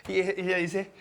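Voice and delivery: ordinary delivery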